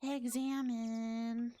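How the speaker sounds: slow, stretched singsong "Examine"; drawn-out announcement. Pretend. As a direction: hold each syllable long. Announcing a game menu choice.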